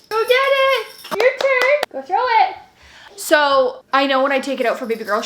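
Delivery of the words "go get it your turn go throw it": bright, much higher voice for the little one. Real, not acted. Mom cheering her toddler to throw the ball, high sing-song baby talk.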